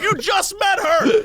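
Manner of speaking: exasperated mock shout at him; incredulous shout. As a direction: yell it in disbelief. Retorting to heartbroken guy.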